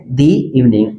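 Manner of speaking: दी evening with दी as "thee"; long ee in दी; "thee" before vowel. Shown as a demonstration. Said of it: "दा evening पढ़ेंगे या दी evening पढ़ेंगे".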